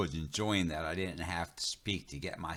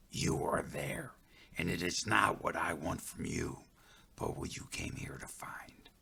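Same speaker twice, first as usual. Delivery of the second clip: whisper; 5 dB quieter than usual. Acted. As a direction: breathe it like a voice in the woods. Spirit's voice answering in the woods.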